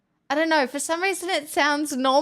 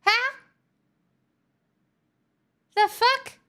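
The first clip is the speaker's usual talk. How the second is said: high, sharp, louder outbursts; shocked disbelief; 12 semitones higher, 4 dB louder than usual. Real, not acted. Answering a chatter's South African jab, high shocked squeaks. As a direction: yelp of offended surprise.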